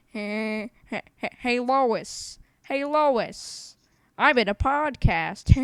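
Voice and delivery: a hint of Peter Griffin calling Lois; lightly voiced cartoon dad; Peter Griffin impression. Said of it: "the Peter Griffin".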